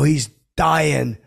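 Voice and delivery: loud, insistent cry; kinda loud. Retold or imitated. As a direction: push it out loud so the room hears. Repeating loudly, insisting.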